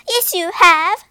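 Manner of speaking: loud, high contradiction; angry cry. A narrator's reading. Wife contradicting Egbert, loud and angry. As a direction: snap back at him loudly. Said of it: "cried his wife".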